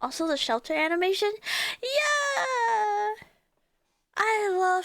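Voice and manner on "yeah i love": excited. Real, not acted. Excited about Shelter animation.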